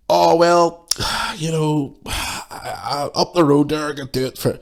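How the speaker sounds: high, sighing haggler voice; mock haggler fishing for a discount; wheedling customer. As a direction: hem and haw like a bargaining customer. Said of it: "customer haggling".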